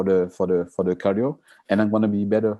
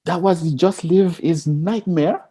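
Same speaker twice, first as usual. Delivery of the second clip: puzzled disbelief; 11 semitones higher, 4 dB louder than usual. Retold or imitated. Imitating puzzled people.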